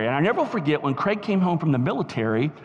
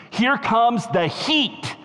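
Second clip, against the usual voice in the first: slow, raised-pitch build on "heat"; emphatic preaching; 6 semitones higher, much slower than usual. Real, not acted. Preacher quotes the theologian's pepper analogy, slow emphatic delivery. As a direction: announce it with weight, stretching the words.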